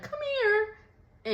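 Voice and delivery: mock whiny plea; high pleading Alex. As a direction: plead like a hurt girlfriend.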